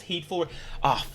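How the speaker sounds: normal talking voice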